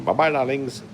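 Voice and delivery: ordinary talk, nothing marked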